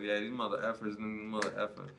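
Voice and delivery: soft grumbling curse under the breath; muttered cursing. Retold or imitated. Annoyed cashier stuck at the register, muttered curse.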